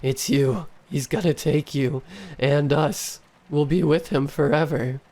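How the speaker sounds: put-on tired, hoarse child's yell. A narrator's reading. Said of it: "With a hoarse and tired voice, the child yelled".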